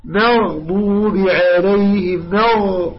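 very slow recitation of مغضوب with full dark غ; measured, drawn-out tajweed demo of مغضوب. Shown as a demonstration. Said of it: "استعلاء".

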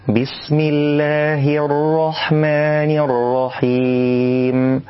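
slow, drawn-out chanted recitation; tajwid recitation. Shown as a demonstration. Teacher recites the correct basmala.

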